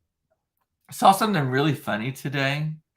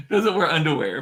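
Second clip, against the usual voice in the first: words broken by real laughter; can barely get it out for giggling; laughing while talking; 3 semitones higher, 3 dB louder than usual. Real, not acted. Laughing at the story.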